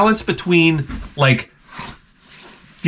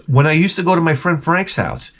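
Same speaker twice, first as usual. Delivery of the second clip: usual speaking voice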